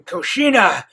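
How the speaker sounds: high, loud mock scream of a beast; hammed-up monster scream. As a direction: yell the name like a trapped fox demon. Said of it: "looks like he's screaming".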